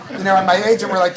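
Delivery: words bubbling out through a laugh; chuckling while telling the story. Real, not acted. Laughing while retelling the scare.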